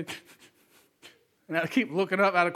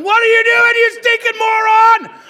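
furious shout; 12 dB louder than usual. Retold or imitated. Dad yells at son over the sprayed Nerf bullets, furious shout. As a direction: blast it like an outraged dad.

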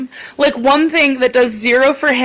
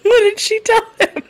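laughing; 11 semitones higher, slower than usual. Real, not acted. Cracking up at the joke.

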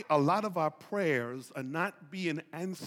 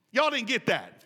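normal talking voice

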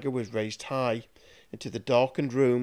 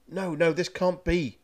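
a higher, desperate cry of denial; 6 semitones higher than usual. Acted. Poisoned master realizing his doom, desperate cry. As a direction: plead with fate as the poison spreads. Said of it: "cried Carrigan".

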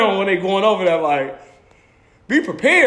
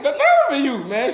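indignant; 7 semitones higher than usual. Retold or imitated. Indignant at kid.

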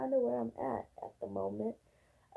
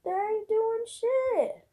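high, slow, louder repeat; exasperated outburst; 11 semitones higher, 4 dB louder, slower than usual. Real, not acted. Ranting to her viewers about lazy officials, exasperated. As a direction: stretch it out in disbelief.

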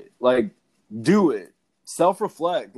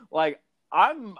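high burst of real laughter; 12 semitones higher than usual. Real, not acted. Laughing at her own punchline, real laughter. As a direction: crack up at your own line.

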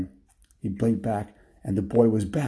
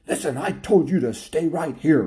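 raised-pitch scolding yell; yelling dog master; 7 semitones higher than usual. Acted. Dog yelling at the lady on a leash, scolding yell. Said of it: "the dog would yell at her".

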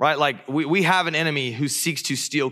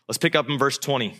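ordinary talk, nothing marked

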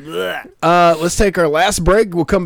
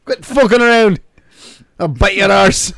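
higher, louder hammed-up Scottish vampire; Scottish Dracula; 9 semitones higher, 4 dB louder, slower than usual. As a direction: Dracula growling at a victim in Scottish. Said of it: "Dracula with Irish or Scottish accent".

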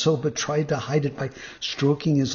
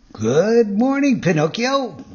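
high, loud, drawn-out hail; courteous fox greeting; 7 semitones higher, 7 dB louder, slower than usual. A narrator's reading. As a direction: greet grandly, stretching the words. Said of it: "greeting him courteously".

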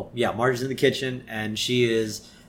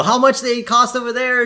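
put-on high, louder kid voice; pitched-up pestering question; Lisa; 12 semitones higher, 5 dB louder than usual. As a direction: pipe up insistently. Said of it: "And Lisa's like".

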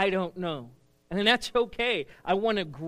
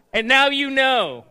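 loud, high, slow catchphrase; with oomph; 6 semitones higher, 10 dB louder than usual. Shown as a demonstration. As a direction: belt it out like a cartoon hero's sign-off. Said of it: "say it with some oomph".

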